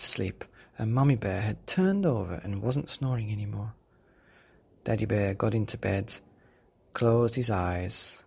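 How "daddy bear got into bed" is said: plain reading voice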